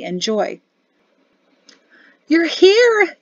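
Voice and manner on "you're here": high, loud cry of joyful relief; excited yell. A narrator's reading. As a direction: yell it out to the arriving family. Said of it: "she yelled uncharacteristically".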